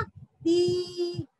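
ती held long and level; long vowel. Shown as a demonstration. Teacher repeating the syllable for children, long-held ती. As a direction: stretch the ee in ती. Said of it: "उसका उच्चारण होगा".

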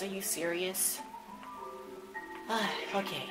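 normal talking voice

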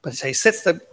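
ordinary delivery

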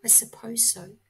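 whispered, unsure little owl; doubtful whisper. Acted. Percy doubts his sister in the dark wood, whispered. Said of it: "said Percy".

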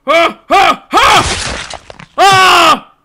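high, loud rising grunts into a yell; panicked straining. Real, not acted. Gamer fighting a tricky jump, panicked straining yells. Struggling through a hard jump.

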